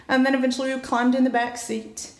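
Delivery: usual speaking voice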